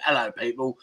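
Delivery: lightly imitated London accent. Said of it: "Are you from London?".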